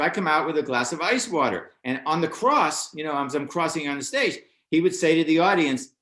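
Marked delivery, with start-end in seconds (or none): none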